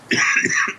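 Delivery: real cough; one dry chesty cough. Real, not acted. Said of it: "sorry if I'm coughing".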